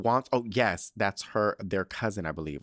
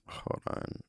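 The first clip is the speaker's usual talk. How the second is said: whispering; 10 dB quieter than usual. Real, not acted.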